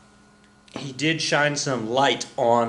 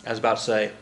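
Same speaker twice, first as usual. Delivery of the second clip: normal talking voice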